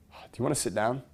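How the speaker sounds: leading to calm. Shown as a demonstration. Coach leading the worked-up person down, gently calming. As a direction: drop the outrage and gently invite. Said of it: "pacing and leading".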